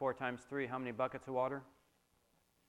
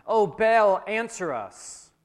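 loud, raised call to a god, slowing on the last words; shouting plea; 7 semitones higher, 10 dB louder, slower than usual. A narrator's reading. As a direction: call out high and loud, then draw out the ending.

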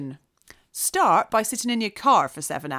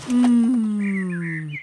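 usual speaking voice

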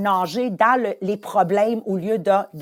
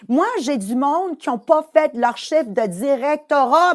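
normal talking voice

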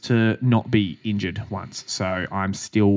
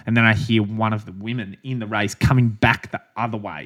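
ordinary talk

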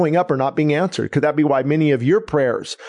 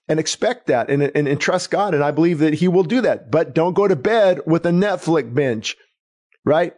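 ordinary talk, nothing marked

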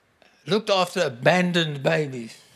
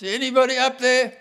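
retold shout; 7 semitones higher, 4 dB louder than usual. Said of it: "And he shouted".

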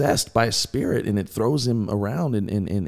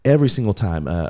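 normal talking voice

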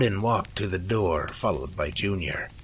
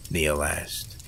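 ordinary delivery

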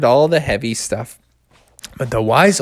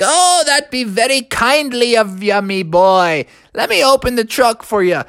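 put-on wise old owl; 9 semitones higher than usual. Old owl thanks boy.

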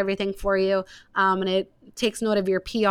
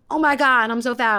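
higher and louder, a hammed-up freak-out over the scale; mock panic; 4 semitones higher, 6 dB louder than usual. Podcast host mocking scale panic after the holidays, higher and louder. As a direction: play the dramatic gasp of someone seeing the scale go up.

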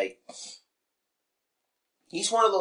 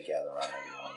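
quiet mock quote; 8 semitones lower, 14 dB quieter, faster than usual. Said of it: "I don't ever wanna hear you say".

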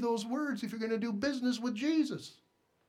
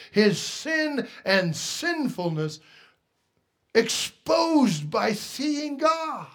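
louder, building to a shouted climax; fervent preaching; 5 dB louder, slower than usual. Acted. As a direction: preach it out with rising fire. Preacher builds to full force.

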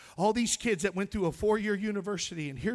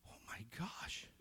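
slow stunned whisper; stunned whisper at the decorated desk; 6 semitones lower, 16 dB quieter than usual. Retold or imitated. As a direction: breathe it out in shock. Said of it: "I'm like".

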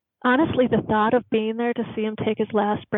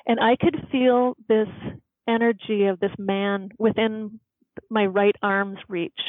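usual speaking voice

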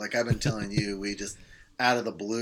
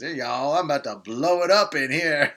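higher, louder mock Southern holler; deep Southern accent; 5 semitones higher, 7 dB louder than usual. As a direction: announce it in a put-on Southern accent. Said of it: "imagining with a deep Southern accent".

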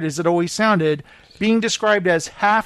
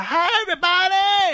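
much higher, mock-shouted greeting; annoying catchphrase; 12 semitones higher than usual. Host mocks actress's catchphrase, high annoying shout. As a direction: imitate an annoying sitcom entrance. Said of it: "this is so annoying. She'd be like".

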